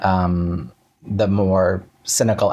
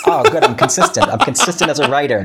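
bright, laughing delight, much higher; 5 semitones higher, 4 dB louder than usual. Real, not acted. Playwright laughing with delight at his own consistency, excited. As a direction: crow happily about being consistent.

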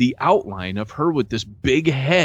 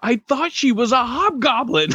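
panicked blurt; 10 semitones higher than usual. Retold or imitated. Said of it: "all I can think to say is".

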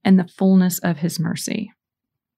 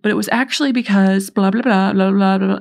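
louder, dismissive run of blahs; mock rambling excuses; 4 dB louder than usual. Imitating a penitent making excuses to the priest, mock rambling. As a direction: rattle off excuses mockingly.

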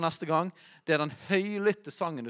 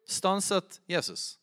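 usual speaking voice

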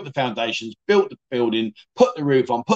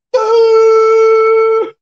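loud high jump-scare "Boo!"; sudden shout; 10 dB louder than usual. Retold or imitated. Mock scaring a dying patient with a sudden shout. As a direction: yell it out of nowhere to make them jump.

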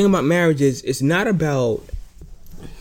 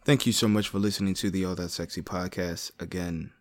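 podcast outro read; 7 semitones lower, 10 dB quieter than usual. Acted. Host reads podcast outro.

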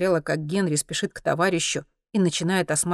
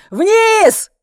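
very high, very loud single word; yelled command; 11 dB louder than usual. A narrator's reading. Zlotan orders Monti down at gunpoint, yelling. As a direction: scream it as an order. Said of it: "заорал Злотан".

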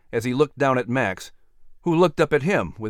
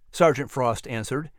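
ordinary delivery, nothing marked

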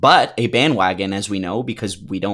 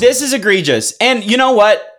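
excited; 10 semitones higher, 4 dB louder than usual. Pretend. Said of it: "Let's do it".

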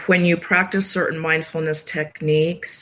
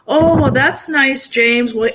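high, sing-song fake-nice tone; sugary brush-off she avoided; mock overly sweet chirp; 8 semitones higher, 4 dB louder than usual. Telling James the voice she didn't use on the call, mock sweet. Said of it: "I didn't go".

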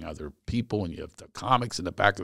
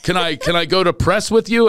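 eager pleading; 10 semitones higher, 8 dB louder than usual. Retold or imitated. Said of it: "the rest of them go".

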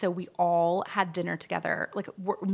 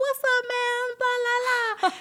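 very high, held-out hail; mock buddy greeting; slower than usual. [name] answering the dudes in the restaurant, mock hearty greeting. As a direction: imitate a chummy shout back, pitched way up.

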